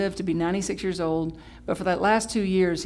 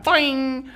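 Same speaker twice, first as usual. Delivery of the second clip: loud, drawn-out cartoon boing; comic sound-effect boing; 6 semitones higher, 8 dB louder than usual. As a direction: stretch out a springy, higher boing. Imitating eyes popping open.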